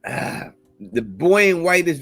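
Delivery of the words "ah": usual speaking voice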